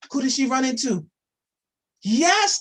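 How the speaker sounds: usual speaking voice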